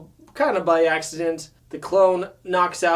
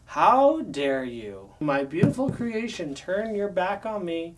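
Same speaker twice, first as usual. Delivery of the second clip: slow, hammed-up villain indignation; mock-dramatic betrayed villain; Megatron; slower than usual. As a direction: draw out the wounded outrage. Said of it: "and he's like".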